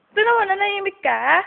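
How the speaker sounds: high, loud, fast playful scolding; excited teasing. Real, not acted. Teasing quiet baby. (mabilis at malakas na panunukso)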